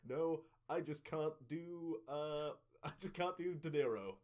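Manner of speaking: high, loud, drawn-out denial; slow, laughing mock helplessness; mock protest. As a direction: protest in playful exasperation with a laugh. Acted protest to the players.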